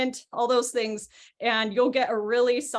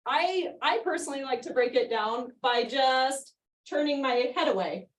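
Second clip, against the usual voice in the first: ordinary delivery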